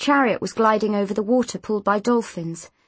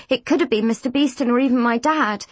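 ordinary delivery, nothing marked

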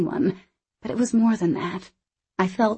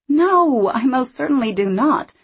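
indignant; 7 semitones higher, 5 dB louder than usual. Acted. Narrator protests loudly.

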